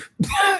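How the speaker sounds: high squealing laugh; squealed giggle. Real, not acted. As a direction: let out a quick squeal of laughter. Laughing burst.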